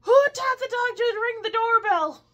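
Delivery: loud, high astonished question; amazed neighbor. Retold or imitated. Neighbor finding the dog at his door, loud amazed question. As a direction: marvel at the clever dog. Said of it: "the guy opens the door going".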